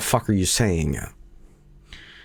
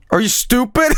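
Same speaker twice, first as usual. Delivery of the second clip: put-on higher, louder incredulous kid; mock kid; 5 dB louder than usual. Imitating the kid who turned around, incredulous. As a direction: sneer it like a baffled child. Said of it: "Looked at me like".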